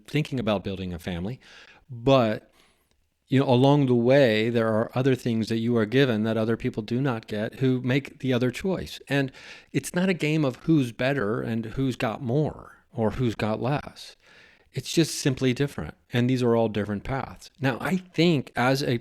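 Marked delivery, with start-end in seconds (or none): none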